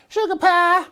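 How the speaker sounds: Jane's voice. Retold or imitated. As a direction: coo it like a doting wife. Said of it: "She's like".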